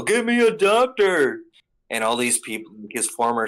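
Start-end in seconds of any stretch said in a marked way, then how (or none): 0.0-1.4 much higher, louder whiny demand; shrill spoiled brat yelling for a doctor; mock Celestial Dragon. Mocking the noble waving his gun, whiny demand. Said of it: "he's like".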